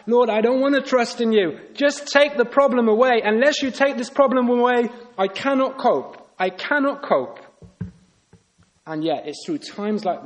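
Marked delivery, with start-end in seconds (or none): 0.1-7.4 acted-out plea, higher pitched, easing on the last words; desperate pleading prayer. Preacher re-enacts his own past prayer to God, desperate pleading. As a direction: pray insistently, pitched up, like someone at their limit. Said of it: "I've prayed like that in the past".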